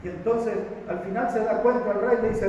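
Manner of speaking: normal talking voice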